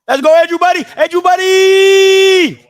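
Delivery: rooting hard for Andrew; cheering. Real, not acted. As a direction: call out to Andrew like from the stands. Cheering on a player.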